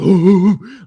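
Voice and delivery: imitated dogs howling, loud and high; dog howls. Retelling the police dogs, howling loud and high. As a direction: howl like police dogs in the distance. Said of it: "some dogs, like".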